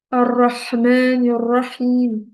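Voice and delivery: "Ar-Rahman", "Ar-Rahim" drawn out slowly with audible H; stretched "Ar-Rahman", "Ar-Rahim", H clearly breathed from the throat; pronounced Arabic H. Shown as a demonstration. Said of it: "vous le dites toutes dans Surat Al-Fatiha".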